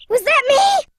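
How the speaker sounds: gasp, then a high, startled question; high-pitched cartoon surprise. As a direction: jump at your own sound. Startled by an unexpected sound.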